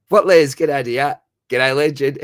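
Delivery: loud, high call-out to a chat regular; hailing a favourite viewer loudly; excited greeting. Real, not acted. Streamer greets chatter Les with a loud high call.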